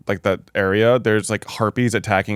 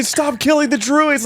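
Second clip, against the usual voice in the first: much higher, slow exasperated plea; mock pleading teammate; slower than usual. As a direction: beg him to stop. Companions beg him to stop.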